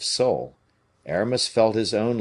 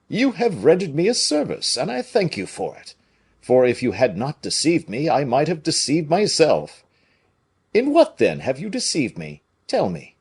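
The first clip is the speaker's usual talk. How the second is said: higher, louder blustering musketeer; big hearty soldier voice, pitched up; Porthos voice; 7 semitones higher, 5 dB louder than usual. A narrator's reading.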